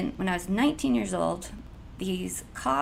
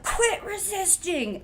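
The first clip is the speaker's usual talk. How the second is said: mock cop order; 12 semitones higher, 3 dB louder, slower than usual. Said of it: "They're like".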